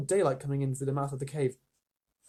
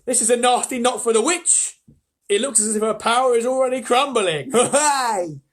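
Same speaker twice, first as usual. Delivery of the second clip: high, loud and slow, bursting with glee; triumphant beaver crowing over the witch; gleeful beaver; 9 semitones higher, 8 dB louder than usual. Acted. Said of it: "almost dancing with delight".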